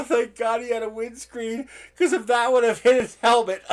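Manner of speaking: laughing through words. Real, not acted. Helpless laughter at the memory.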